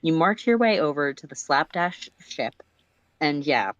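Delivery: ordinary delivery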